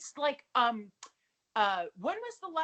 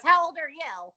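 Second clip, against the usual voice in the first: obnoxious question; 5 semitones higher, 7 dB louder than usual. Retold or imitated. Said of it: "an extremely obnoxious manner".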